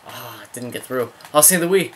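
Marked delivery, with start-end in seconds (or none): 1.3-1.9 raised, louder cry at a missed shot; pained near-miss reaction; exasperated cry. Real, not acted. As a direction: cry out to the player in frustration. Missed shot in live play.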